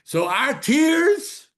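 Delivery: high, loud cries of being stuck; fervent preaching. Acted. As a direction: preach the trap with urgency. Preaching at full force.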